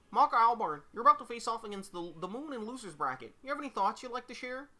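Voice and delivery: high-pitched Kermit-style reporter voice; high, quick puppet reporter. Acted. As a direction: interview questions in a high Kermit impression. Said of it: "I gotta do the Kermit voice".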